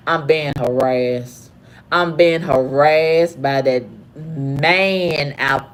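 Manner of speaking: low, slow and deliberate; measured complaint. Retold or imitated. Guest reporting harassment to the hotel desk man, slow and deliberate.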